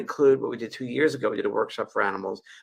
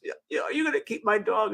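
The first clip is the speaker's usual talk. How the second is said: higher, pleading voice of the friend; imitated friend; 11 semitones higher than usual. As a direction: imitate the friend asking eagerly. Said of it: "he goes".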